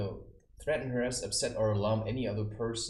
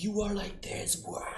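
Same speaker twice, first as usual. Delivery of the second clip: mocking whiny brother; 10 semitones higher than usual. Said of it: "He was like".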